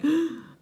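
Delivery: sharp high gasp of surprise; shocked gasp. Retold or imitated. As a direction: gasp as if seeing the bill. Shocked at the till price.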